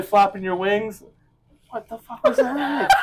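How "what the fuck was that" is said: high, laughing shout; laughing disbelief. Real, not acted. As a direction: laugh out the question. Reacting to flapping demo.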